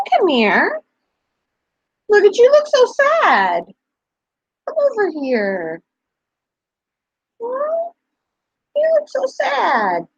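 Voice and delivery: very high, sing-song coo, slowing on the sad lines. Real, not acted. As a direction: plead sweetly with a shy pup. Coaxing the dog to come over.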